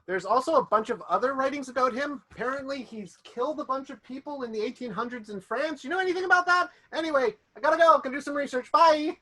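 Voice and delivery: high, bright-pitched caller rushing off the phone; caller character voice. Acted. Phone caller to player character, high quick chatter.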